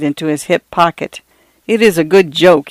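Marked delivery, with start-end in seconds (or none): none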